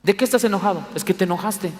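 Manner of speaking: usual speaking voice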